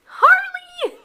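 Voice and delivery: high laughing name. Real, not acted. Showing dog's ornament, laughing.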